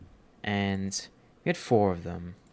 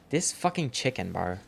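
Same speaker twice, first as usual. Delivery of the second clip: ordinary talk, nothing marked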